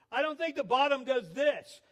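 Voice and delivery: mock yell of protest from the boat; retold yelling. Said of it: "heard Kathy yelling at me".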